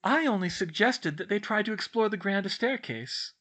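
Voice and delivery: high, innocent-sounding smile; smiling. A narrator's reading. Hermione excuses herself to Ron, smiling. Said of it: "still smiling".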